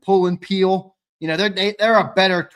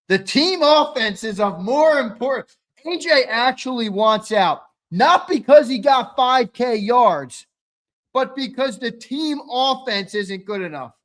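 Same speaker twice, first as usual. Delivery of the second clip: mocking Twiz; 6 semitones higher, 5 dB louder than usual. Mocking Twiz's take, higher.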